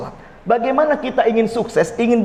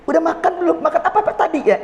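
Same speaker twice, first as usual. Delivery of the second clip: higher, coaxing wife checking on her husband; sweet wife asking; 7 semitones higher than usual. Retold or imitated. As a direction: sweetly ask the husband, with a laugh.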